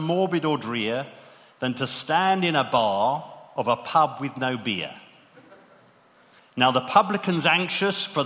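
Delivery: hammed-up Aussie accent, reciting a comic ballad; lilting Australian verse recital. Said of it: "say this in an Australian accent".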